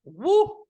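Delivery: squealing woo from the sourness; high shriek. Real, not acted. Reacting to sour candy.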